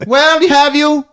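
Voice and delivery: put-on high, wide-eyed little boy asking; kid voice. Kid asking his dad about the roof in a high voice. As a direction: squeak it out like a suspicious child. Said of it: "Pietri is like".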